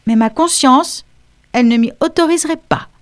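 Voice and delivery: a touch of cool, lofty dignity; icy dignity. A narrator's reading. Mathilda refuses Mrs Dawson on grounds of conscience, icy dignity. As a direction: refuse primly, above it all. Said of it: "avec une dignité glaciale".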